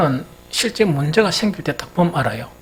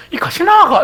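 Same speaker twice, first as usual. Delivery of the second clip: high, loud incredulous outburst; dumbfounded robber; 12 semitones higher, 8 dB louder than usual. Retold or imitated. The robber, dumbfounded, lashes out at the sister. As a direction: blurt it in stunned disbelief. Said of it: "기가 차가지고".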